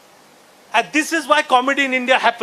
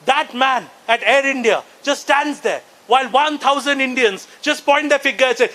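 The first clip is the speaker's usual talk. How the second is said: usual speaking voice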